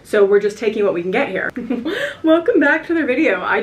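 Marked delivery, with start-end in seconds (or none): 1.6-2.2 short high giggle; a quick high burst of laughter; real tipsy giggle. Real, not acted. Laughing at her tipsy retakes. 2.2-3.4 higher, laughing through the words; real tipsy laugh while talking. Real, not acted. Restarts intro while laughing.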